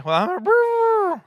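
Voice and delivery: high, loud comic bawling wail; mock crying. Preacher asked how he's doing at lunch, mock bawling. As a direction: break into a hammed-up blubber, drawn out. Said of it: "I'll cry the whole time".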